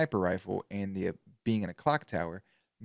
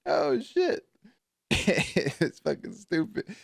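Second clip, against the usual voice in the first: laughing; 7 semitones higher, much slower than usual. Real, not acted. Laughing fit after joke.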